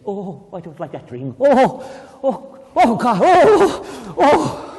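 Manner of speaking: hammed-up scared fish; mock nightmare. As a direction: play the fish waking from a bad dream. Fish having a bad dream.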